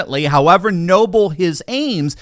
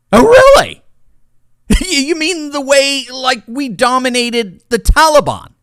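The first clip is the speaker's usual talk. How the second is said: much higher and louder mock disbelief, then a laugh; sarcastic scoff; 7 semitones higher, 4 dB louder than usual. Real, not acted. Mocking the senators on dominating Russia, sarcastic. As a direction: scoff at the claim as if it's absurd.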